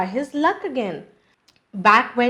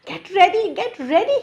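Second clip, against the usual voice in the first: panting jackal; 8 semitones higher, faster than usual. A narrator's reading. Jackal racing to warn the lion, urgent panting calls. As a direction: hurry the lion, out of breath. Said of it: "he panted".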